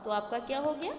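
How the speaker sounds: ordinary talk, nothing marked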